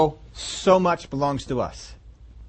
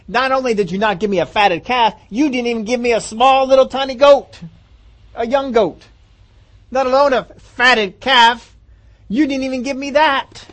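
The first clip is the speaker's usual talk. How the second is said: resentful son; 6 semitones higher, 4 dB louder than usual. Retold or imitated.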